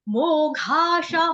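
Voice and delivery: मोघाशा sung slowly with long ओ and aspirated घ; careful pronunciation. Shown as a demonstration. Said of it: "उच्चारण समझा देती हूँ". (मोघाशा धीरे, दीर्घ ओ के साथ)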